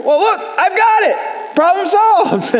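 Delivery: very high-pitched, dismissive punchline; breezy self-justification. Acted. Acted friend's punchline in dispute.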